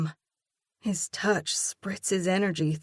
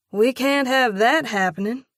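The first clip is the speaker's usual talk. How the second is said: higher, louder comeback; quick sharp retort, higher and louder; 7 semitones higher, 6 dB louder than usual. A narrator's reading. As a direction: fire it back at him. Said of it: "I retort".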